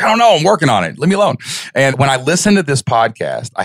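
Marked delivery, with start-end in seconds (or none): 0.0-1.4 high, exasperated brush-off; mock exasperated. Husband brushing off his wife, high exasperated whine. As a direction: shoo her away, flustered.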